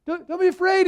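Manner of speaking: high, chummy reassurance; mock casual Jesus. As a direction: play it as an over-friendly pal calming people down. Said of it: "this is not just Jesus going".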